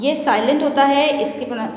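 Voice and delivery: ordinary talk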